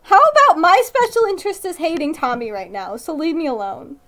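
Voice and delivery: loud, high annoyed protest; playful loud exasperation; exasperated outburst. Real, not acted. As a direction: shout them off in mock anger. Reading fanfic, mock angry outburst.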